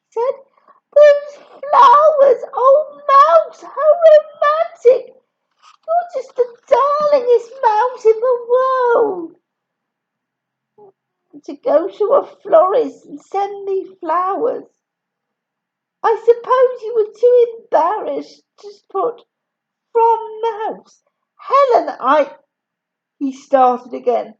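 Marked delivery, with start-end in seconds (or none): 0.9-21.0 very high, loud, swooning gush; gushing delight. Acted. Helen swooning to Mouse over the flowers, squealing high. 21.4-22.4 flustered fiancé. Acted. Fiancé trying to explain.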